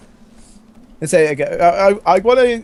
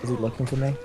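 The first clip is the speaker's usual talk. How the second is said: soft mock mutter; 10 dB quieter, faster than usual. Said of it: "It's like".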